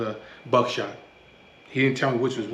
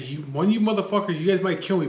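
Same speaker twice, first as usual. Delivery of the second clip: higher, louder defiant threat; raised retold threat; 6 semitones higher, 4 dB louder than usual. Man threatening two gunmen in a standoff, loud and defiant. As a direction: stand your ground and shout it.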